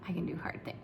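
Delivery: breathy whispered mantra to herself; whispered self-talk. Retold or imitated. Teacher reassuring herself through hard times, whispered. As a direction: whisper it like a private promise. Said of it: "I keep telling myself".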